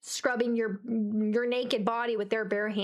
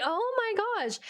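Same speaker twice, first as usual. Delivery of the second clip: high, stretched mock horror; hammed-up falling cry; retold dismay; 10 semitones higher, much slower than usual. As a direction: react in comic mortification. Reacting to being stared at.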